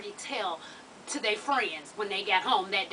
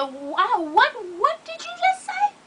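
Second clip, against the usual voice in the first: high, shrill what did you just say; outraged disbelief; 11 semitones higher, 4 dB louder, slower than usual. Retold or imitated. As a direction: shoot up in pitch, offended. Outraged retort in story.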